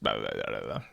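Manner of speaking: low, garbled stutter noise; mock stutter. Shown as a demonstration. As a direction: imitate your own stutter as nonsense syllables. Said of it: "sometimes my stutter just".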